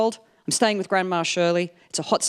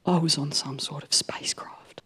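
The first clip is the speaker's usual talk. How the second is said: eerie whisper; 5 semitones lower, 6 dB quieter than usual. Retold or imitated. Grandma tells of the spacecraft.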